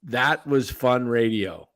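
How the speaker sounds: ordinary delivery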